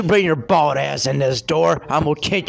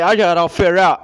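mocking quote; 3 semitones higher, 5 dB louder, faster than usual. Ranting man mocks the officer's boast, laughing sneer. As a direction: throw his smug words back at him with a laugh. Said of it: "You say".

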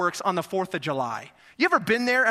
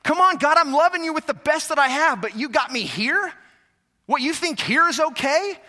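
loud, high exasperated plea to God; frustrated believer's loud complaint; 8 semitones higher, 6 dB louder than usual. Retold or imitated. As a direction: vent at God, fed up. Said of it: "I get frustrated".

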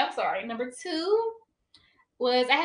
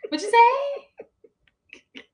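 drawn out, high and slow in fake disbelief; mock outrage; 14 semitones higher, much slower than usual. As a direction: act scandalized at being called controlling. Jokingly offended at being called controlling.

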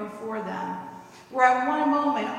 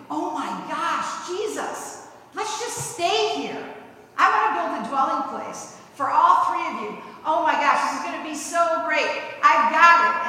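eager, higher and louder gushing; excited Peter; 5 semitones higher, 6 dB louder than usual. Retold or imitated. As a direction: Peter bursting with excitement, wanting to stay. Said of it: "what does Peter want to do?".

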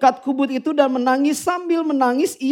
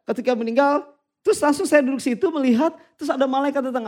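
usual speaking voice

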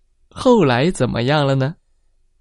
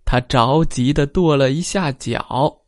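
ordinary delivery, nothing marked

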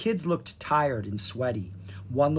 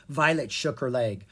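ordinary delivery

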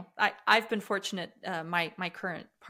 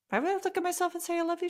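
incredulous; 10 semitones higher, faster than usual. Retold or imitated. Retelling her disbelief at the coach.

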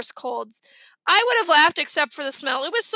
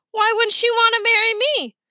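put-on indignant little boy; 9 semitones higher, 6 dB louder than usual. Fudge indignant child voice.

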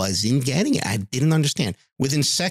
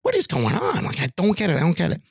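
exasperated puzzlement; 7 semitones higher, faster than usual. Retold or imitated. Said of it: "And I'm like".

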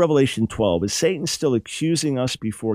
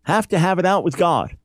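higher, earnest Job demanding a hearing; Job's impassioned protest, higher; voicing Job; 5 semitones higher than usual. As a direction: plead with God as Job would. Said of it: "So Job's saying".

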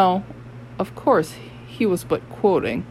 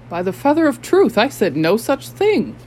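higher, louder, panicked denial; gasped denial; 6 semitones higher, 4 dB louder than usual. A narrator's reading. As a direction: deny it in a breathless rush. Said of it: "gasped Nekonk".

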